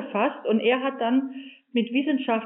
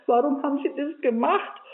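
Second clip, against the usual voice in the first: ordinary talk, nothing marked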